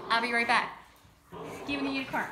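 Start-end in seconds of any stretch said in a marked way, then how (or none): 1.6-2.2 louder and higher, insistent; raised voice to the dog; firm demand. Real, not acted. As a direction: make the dog hand it over. Takes toy from dog.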